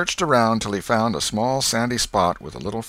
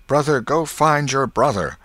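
higher, louder spell call; charm incantation; 7 semitones higher, 6 dB louder than usual. A narrator's reading. Tossing the marble.

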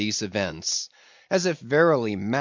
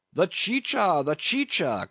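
high, urgent call for the drink; urgent cry; 6 semitones higher than usual. A narrator's reading. Don Pedro calling for drink for the faint narrator, urgent. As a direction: call for the chicha in a hurry. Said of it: "cried Don Pedro".

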